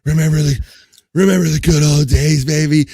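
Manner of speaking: loud, drawn-out sleazy come-on; sleazy lover voice. Pretend. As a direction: lay it on like a cheesy lover. Plays a sleazy caller, loud.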